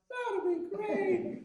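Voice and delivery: put-on high, slow sing-song kid voice; little boy voice. Nine-year-old boy asks the trumpeter to sign a plunger, in a high sing-song kid voice. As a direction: play an eager nine-year-old asking sweetly. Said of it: "he's like".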